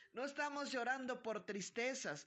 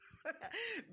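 amused laugh; 12 semitones higher than usual. Real, not acted. Laughs at "strong woman" remark.

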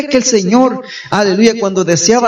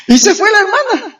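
high-pitched, tickled laughing aside; 12 semitones higher than usual. Real, not acted. Preacher telling the congregation a funny anecdote, laughing. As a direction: say it through real laughter, pitched way up.

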